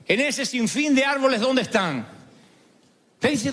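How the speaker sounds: ordinary talk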